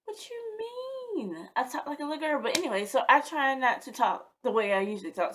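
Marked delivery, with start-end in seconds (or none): 0.1-2.4 high-pitched incredulous retort; mock disbelief. Girl answering friends who teased her phone voice, mock disbelief. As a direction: act taken aback, pitch jumping up. Retold reaction to friends' remark.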